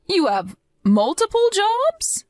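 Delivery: can't believe what she hears; shocked disbelief. Acted. Mother-in-law learning Christine works multiple jobs, stunned.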